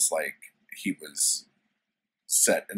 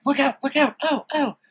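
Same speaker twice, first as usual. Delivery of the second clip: woman's voice; 8 dB louder than usual. Retold or imitated. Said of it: "she's like".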